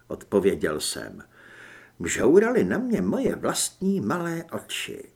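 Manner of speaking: ordinary delivery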